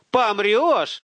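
high, loud incredulous question; alarmed high-pitched outburst; shocked realization. A narrator's reading. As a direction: blurt it out as it hits home. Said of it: "вдруг дошло до Руслана".

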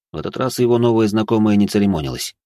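ordinary delivery, nothing marked

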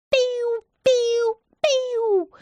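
very high piu piu noises; fireworks whistle. Acted. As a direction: whistle like rockets going up. Imitating fireworks.

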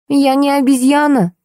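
high, indignant retort. Acted. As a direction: bristle at the suggestion. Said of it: "возмутилась Зайка".

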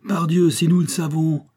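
loud, high-pitched retort; defiant retort. A narrator's reading. Billaud answering the German officer, loudly defiant. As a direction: fling back a proud answer.

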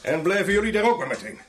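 loud, firm order; forceful command. Acted. Gunman locking up police, forceful command. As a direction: order them to stay right there.

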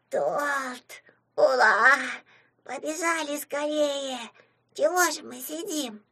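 put-on high, childlike imp voice, drawn out; joyful squeak. A narrator's reading. Puzatik hearing about cake, high happy squeal. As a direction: squeal it like an eager little tot. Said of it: "Пузатик радостно пискнул".

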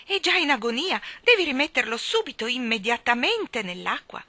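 high, loud, urgent command; indignant order. A narrator's reading. Lady orders boy to save fish, indignant. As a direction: insist on it, slowing at the end.

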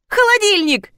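high excited shout; delighted high-pitched cry. Acted. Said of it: "закричала Таисия".

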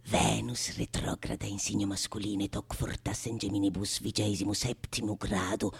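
low, whispered Latin spell of a witch; whispered incantation. Acted. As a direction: murmur the conjuring formula in secret. (formula magica sussurrata da strega)

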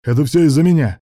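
high, loud confession; frantic cry. A narrator's reading. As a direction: cry it out desperately. Frantic confession as police approach.

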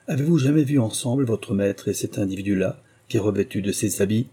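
usual speaking voice

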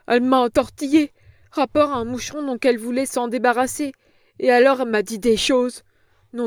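put-on a cringing old woman protesting; frightened old crone. A narrator's reading. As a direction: plead like a scared old hag.

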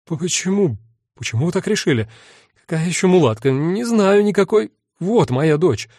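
high, rattled and defensive; a scared man blurting denials; frightened denial. A narrator's reading. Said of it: "испугался бы меньше".

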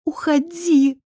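high-pitched, hostile command; hissed order. A narrator's reading. Frightened woman orders the intruder out of her bedroom, hissing. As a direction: order him out tensely. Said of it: "прошипела я".

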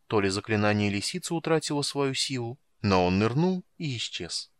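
usual speaking voice

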